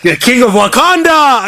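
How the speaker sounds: loud, high grand announcement; excited. Real, not acted. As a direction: proclaim it like a herald.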